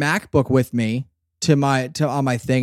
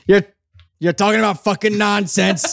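higher quoted character line; 6 semitones higher than usual. Retold or imitated. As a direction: echo the drama line in character. Repeating the drama line.

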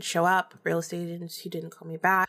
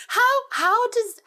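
incredulous; 5 dB louder, slower than usual. Real, not acted. Incredulous rant starts.

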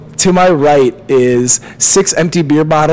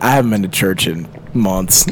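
sheepish mumble; 8 semitones lower, 6 dB quieter than usual. Retold or imitated. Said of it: "wanna be like".

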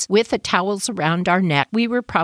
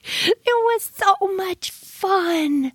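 wistful; 12 semitones higher, much slower than usual. Real, not acted. Nostalgic drawn-out memory.

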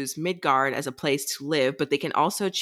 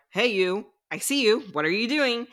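higher-pitched quoted greeting; playful call-out; 7 semitones higher than usual. Retold or imitated. Baxian surprising two people as they leave, playful call-out. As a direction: lightly act out catching someone sneaking off.

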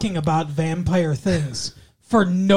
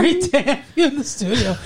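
laughing; 8 semitones higher than usual. Real, not acted. Laughing through studio welcome.

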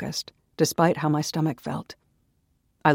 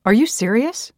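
higher, louder indignant demand; 8 semitones higher, 8 dB louder than usual. A narrator's reading. Naomi snapping back at Daniel, loud and outraged. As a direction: fire it back at him, outraged. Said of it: "I asked loudly".

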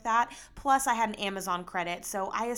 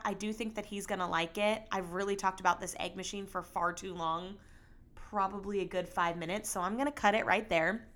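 usual speaking voice

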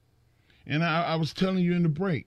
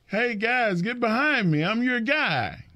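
higher, pleading fan-pleasing mock; mock crowd-pleaser; 9 semitones higher than usual. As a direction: ham up a needy good guy begging for cheers. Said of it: "He wasn't going to the ring going".

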